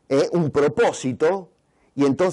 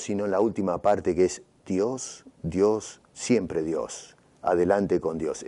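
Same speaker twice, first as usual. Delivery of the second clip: Dios repeated, low and softening; stress on Dios; 6 semitones lower, 4 dB quieter than usual. Shown as a demonstration. Preacher pointing the congregation to God, voice dropping lower and softer. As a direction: sink into a hushed Dios, siempre Dios. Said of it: "el énfasis está no en la primera parte".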